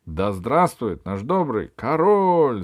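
loud, slow courtiers' cheer; cheering crowd. Acted. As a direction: cheer for the king. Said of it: "закричали придворные". (крик придворных)

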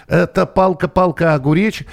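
ordinary talk, nothing marked

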